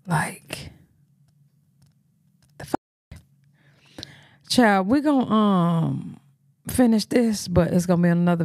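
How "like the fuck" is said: soft incredulous whisper trailing off; whispered aside. Real, not acted. Host whispers disbelief to viewers about the clip. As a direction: mutter it under your breath in disbelief.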